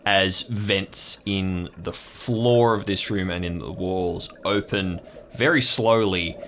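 ordinary talk

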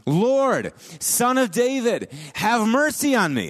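high, pleading call, slow on "Son of David"; pleading cry. Retold or imitated. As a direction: cry out to him for mercy. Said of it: "she keeps calling out to him".

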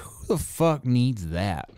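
slow, changed-voice scoff at a gadget. Retold or imitated. Podcaster mocking his first reaction to a charger, scoffing. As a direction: scoff like it's useless. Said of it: "I was like".

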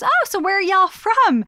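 a hint of a chatty Texas neighbor; light Texan twang asking where they're from; Texas accent. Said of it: "she's got the Texas accent".

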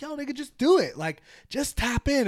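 high, loud, insistent urging; egging on. Retold or imitated. Urging homies to tap in with females, high and loud. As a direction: push your buddy to go for it.

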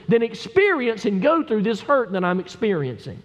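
high, forceful pulpit cry of betrayal; impassioned preaching. Acted. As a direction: press each phrase as the wounded psalmist. Preaches in David's wounded voice.

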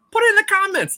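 playful high-pitched squeak; high squeaky voice. Acted. Playful catchphrase to viewers.

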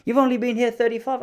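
put-on high, cheeky old man voice; proud stallholder. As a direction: toss it back with pride.

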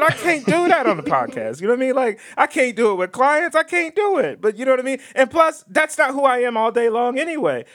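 high-pitched animated protest; fired-up insistence, pitch riding high; spunky, lit up. Real, not acted. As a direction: make the case all worked up. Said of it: "I love your spunk today".

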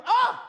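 sharp high cry of pain; loud mock labor-pain cry. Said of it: "pains hit her".

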